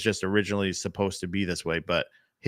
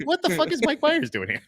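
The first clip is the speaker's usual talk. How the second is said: much higher, incredulous outburst; mock disbelief. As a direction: squeal it in fake shock. Imagined viewer seeing Mike Myers.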